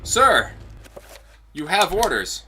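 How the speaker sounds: guard calling out high and loud; game guard. Acted. Guard hailing an officer about his orders, high and loud.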